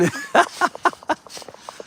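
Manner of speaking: high airy laugh mid-bounce; breathless bouncing laugh. Real, not acted.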